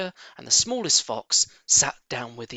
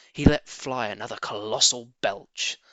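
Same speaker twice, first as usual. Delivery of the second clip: usual speaking voice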